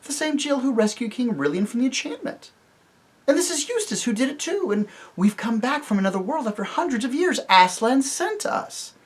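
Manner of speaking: put-on a brave girl insisting she is telling the truth; Jill, earnest girl. Jill tells the dwarfs who she is, earnestly.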